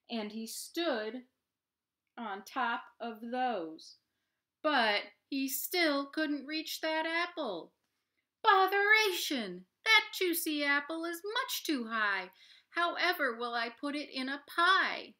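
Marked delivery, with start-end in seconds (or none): 4.6-7.7 building refrain. A narrator's reading. 8.4-15.1 high, loud exasperated cry of botheration; frustrated Mister Bingle. Acted. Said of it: "Mister Bingle's lament".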